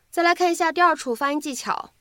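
ordinary talk, nothing marked

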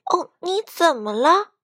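mildly anxious; uneasy. A narrator's reading. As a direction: ask with a touch of worry. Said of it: "卡门不安地问道".

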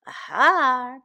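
lightly voiced purring cat. A narrator's reading. Cat accepts the hedgehog's answer, lightly purring. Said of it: "purred the cat".

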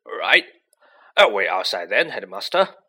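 loud, lightly acted big-man voice; lightly voiced gruff helper. A narrator's reading. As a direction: announce it loudly, backing down. Hagrid, embarrassed, backs down in story.

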